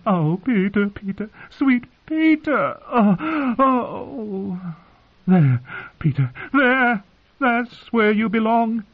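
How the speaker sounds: very high, drawn-out cries of passion; aunt moaning loudly. A narrator's reading. Aunt to her husband, overheard by the whole hall, high loud moaning. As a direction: wail in ecstasy, way up high. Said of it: "her aunt moaned".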